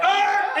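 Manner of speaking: high, sustained "ahh" buzz; mock buzzer noise; imitated wrong-answer buzzer. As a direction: buzz them like a game show. Buzzer before saying incorrect.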